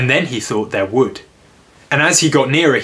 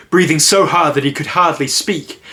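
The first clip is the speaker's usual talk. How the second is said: plain reading voice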